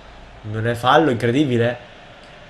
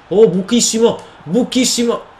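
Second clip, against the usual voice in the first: high, loud cry of dismay; frantic, high-pitched gamer reaction; alarmed shout; 10 semitones higher, 6 dB louder than usual. Real, not acted. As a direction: yell in alarm at the gaping defence. Reacts to hole in defence.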